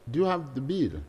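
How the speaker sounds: normal talking voice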